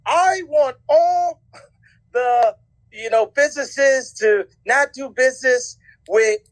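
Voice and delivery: slow, high-pitched mock proclamation; Ari Emanuel. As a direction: draw out each word as a pompous decree. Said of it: "I'm Ari Emanuel".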